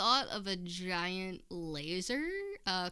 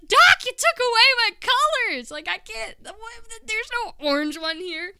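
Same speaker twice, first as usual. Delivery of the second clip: high, loud, panicky cry at the doctor; patient yelling at the doctor in mock panic, high and loud; panicked yell; 11 dB louder than usual. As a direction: shriek it like your colours just vanished. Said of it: "so I, I panicked".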